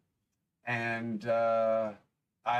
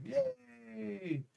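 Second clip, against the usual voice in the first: high, ironic little cheer; a thin, half-hearted hooray; mock cheer; 10 semitones higher, 5 dB quieter than usual. Making the scan bigger for Lynn, a mock cheer.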